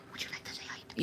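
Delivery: hushed, voiceless whisper to a pet. Real, not acted. Coaxing the cat to say hi, whispered. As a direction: drop right down into a whisper. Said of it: "Why is he whispering?".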